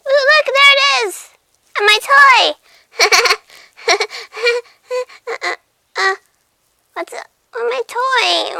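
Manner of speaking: high, loud excited kitty squeal; tiger cub voice. Pretend. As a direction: squeal over the toy like a playful cub. Cat finds her toy.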